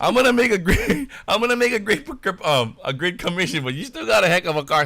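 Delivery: high, stumbling damage control; flustered excuse. Retold or imitated. Caught salesman scrambling to reassure the customer, high and flustered. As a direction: laugh it off nervously and backpedal. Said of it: "I'm just like".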